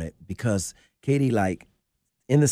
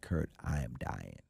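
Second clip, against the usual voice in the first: dying friend, hushed; 7 semitones lower, 10 dB quieter than usual. Retold or imitated. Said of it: "He said".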